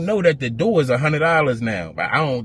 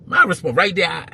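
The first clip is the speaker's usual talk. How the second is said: ordinary talk, nothing marked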